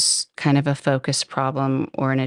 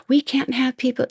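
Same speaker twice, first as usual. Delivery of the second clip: mock yelling; 10 semitones higher than usual. Winery woman scolding drivers at her venue, mock yelling. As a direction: imitate a woman telling someone off, pitched up. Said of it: "she just kept yelling and yelling".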